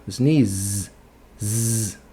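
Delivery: sneeze, then a buzzing Z alone; último som. Shown as a demonstration. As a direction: draw out the final Z of sneeze. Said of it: "Qual é o último som?". (sneeze destacando o Z vibrado)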